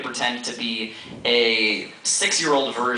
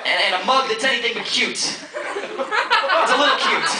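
slam poetry; 6 semitones higher than usual. Acted. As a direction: deliver it like a slam poet. Performs a slam poem.

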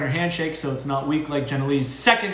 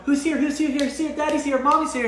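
put-on high, gushing baby-talk hello to the dog; amped-up homecoming greeting; 10 semitones higher than usual. Owner coming home and gushing at the dog, high excited squeal. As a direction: rev the dog up with a squealing welcome. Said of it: "and get my dog amped up".

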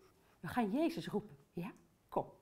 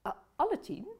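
usual speaking voice

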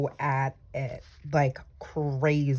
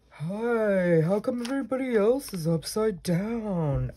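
higher, put-on little snail voice; 5 semitones higher than usual. Crafter voicing her upside-down sticker, cartoon snail voice. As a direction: play a puzzled cartoon snail. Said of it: "that's the way a snail would talk".

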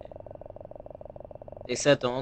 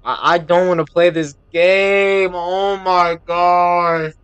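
higher and louder, stretched out; scared whine; 5 semitones higher, 11 dB louder, slower than usual. Real, not acted. Gamer dreading the dark woods, whining loudly. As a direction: drag out the dread.

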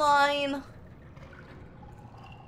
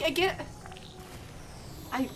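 ordinary talk

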